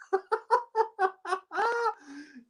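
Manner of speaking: high staccato laugh; high-pitched cackling laughter. Real, not acted. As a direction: burst into a high, rapid giggle. Laughing at the absurd scene.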